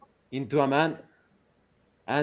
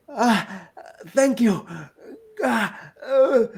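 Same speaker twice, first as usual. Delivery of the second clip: put-on frightened girl; 8 semitones higher, slower than usual. Rescued girl gasping thanks.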